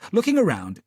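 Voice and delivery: plain reading voice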